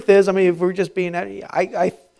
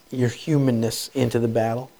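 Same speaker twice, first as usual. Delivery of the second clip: ordinary talk, nothing marked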